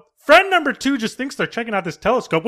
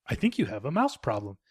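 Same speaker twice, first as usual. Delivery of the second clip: deadpan aside; 8 semitones lower, 11 dB quieter than usual. Retold or imitated. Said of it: "friend number two's like".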